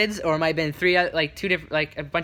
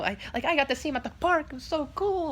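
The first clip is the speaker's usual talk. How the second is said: put-on high child voice, softer and rushed; imagined excited kid; 11 semitones higher, 4 dB quieter than usual. Kid home from the park, imitated excitement. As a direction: breathlessly share big news like a kid. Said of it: "go home and be like".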